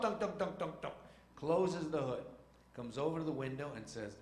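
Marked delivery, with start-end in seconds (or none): 0.0-0.9 knocking that drops in pitch and dies away; imitated engine knock. Driver easing off the gas, imitated slowing clunk. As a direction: mimic an engine winding down. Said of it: "hear a funny noise in the engine".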